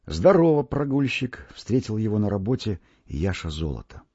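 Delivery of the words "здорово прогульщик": higher, louder hearty hail; teasing greeting. Acted. Old turner greeting the boy at work, loud and teasing. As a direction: greet him with a jibe.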